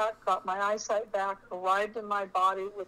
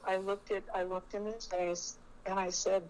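normal talking voice

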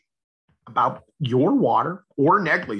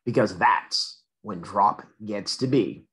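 ordinary delivery

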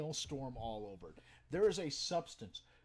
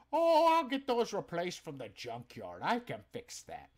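customer's voice; 3 semitones higher, 7 dB louder than usual. Retold or imitated. Customer brushing off problems.

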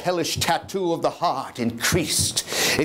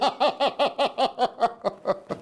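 long, high, breathy madman cackle; 7 semitones higher than usual. Acted. Madman boasting to his listener of the clean crime, long mad cackle. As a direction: cackle with pride at his own cunning.

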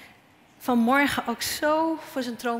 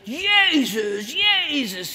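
retold yelling; 5 semitones higher, 6 dB louder, much slower than usual. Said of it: "hij begon te schreeuwen".

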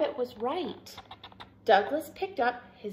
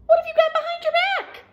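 very high, loud, rapid question; quick, high-pitched little-character voice; young Douglas asking; 7 dB louder, much faster than usual. A narrator's reading. Douglas asking Dad what is behind his back, eager high question. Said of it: "asked Douglas".

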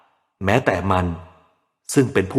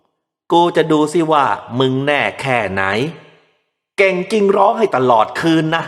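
put-on drunken taunt; 6 semitones higher than usual. A narrator's reading. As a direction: taunt the baby like a nasty drunk. Drunk father taunting crying baby.

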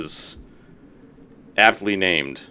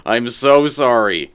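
slow, louder stretched apology; slow exaggerated apology; drawn-out sorry; 3 semitones higher, 7 dB louder, much slower than usual. Real, not acted. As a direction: stretch out every word of sorry.

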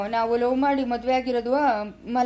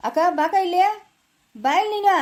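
higher, louder urgent call; 7 semitones higher, 5 dB louder than usual. Acted. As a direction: call her over sharply, raised voice. Calling sister over urgently. (ಜೋರಾಗಿ ಕರೆಯುವುದು)

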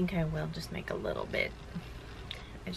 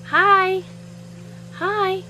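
put-on much higher and louder, eager little-girl hi; toddler calling out; 13 semitones higher, 8 dB louder, slower than usual. Mom imitates toddler calling to kids in buggies, high and loud. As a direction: call out like a toddler desperate for attention. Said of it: "Journey's like".

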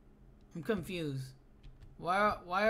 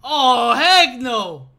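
loud heck no; 3 semitones higher, 12 dB louder, much slower than usual. Real, not acted. Gamer yelling at a wall of text on screen, loud. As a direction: shout it down in disbelief.

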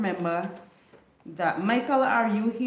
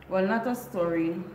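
usual speaking voice

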